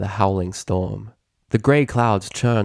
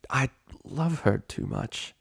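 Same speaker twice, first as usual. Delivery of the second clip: softer, slow, shy confession; barely audible; 3 semitones higher, 5 dB quieter, slower than usual. Acted. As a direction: force out the admission quietly. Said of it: "her voice barely scraping the walls".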